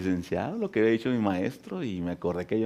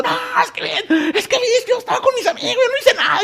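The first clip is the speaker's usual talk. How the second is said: panicked crying cousin; 6 dB louder than usual. Retold or imitated. Scared jailed cousin pleading.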